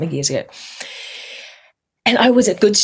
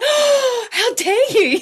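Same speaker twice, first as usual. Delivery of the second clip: very high, laughing mock indignation; mock outrage; much slower than usual. Answering her laughing mum in hammed-up outrage. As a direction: gasp it in hammed-up offence. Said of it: "And I'm like".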